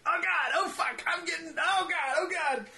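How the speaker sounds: high panicked shouting; mock panic. Stoned narrator panicking at the cinema, high mock panic. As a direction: freak out, voice way up.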